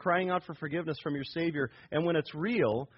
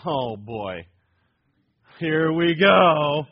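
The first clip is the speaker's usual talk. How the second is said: mock weary groan; 9 dB louder, much slower than usual. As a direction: drawl it like a fed-up coworker. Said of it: "They're gonna go".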